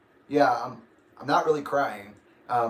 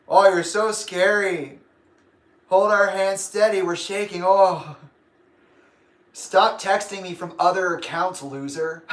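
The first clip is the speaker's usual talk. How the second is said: louder, higher sarcastic taunting; sarcastic mock fear; 5 semitones higher, 5 dB louder than usual. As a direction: pretend to be scared, dripping sarcasm. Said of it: "my friend said".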